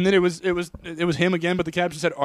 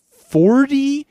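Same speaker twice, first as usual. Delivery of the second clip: much higher and louder, slow disbelief; incredulous; 10 semitones higher, 7 dB louder, much slower than usual. Real, not acted. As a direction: stretch it out in disbelief. Incredulous at advice.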